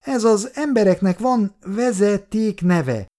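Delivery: high, syllable-by-syllable little teacher; lecturing child. A narrator's reading. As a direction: spell it out like a child instructing a grown-up. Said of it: "szótagolta oktató hangon a gyerek". (kislányos, kioktató, szótagoló hang)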